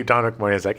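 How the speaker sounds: normal talking voice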